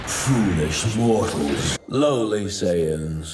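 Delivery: low, slow contempt; godly disdain. Acted. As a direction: look down on them as lesser beings. God sneers at mortals.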